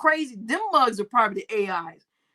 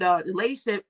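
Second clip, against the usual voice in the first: normal talking voice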